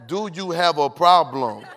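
slow, loud, with attitude; confrontational attitude. Acted. Texter read as catching an attitude, slow confrontational challenge. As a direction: spell it out slowly like a challenge. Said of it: "catching an attitude with you".

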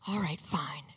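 soft, low whispery concession; hushed, low imitated surrender; grudging giving in. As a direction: give in under the breath, imitating a drowsy mother. Drowsy mother gives in, retold.

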